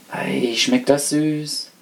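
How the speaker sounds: slow, savouring delight in a lightly voiced rat; delighted exclamation. A narrator's reading. Rat tasting the syrup off her tail, slow delight. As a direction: relish every word of the sweet taste. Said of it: "rief sie erfreut".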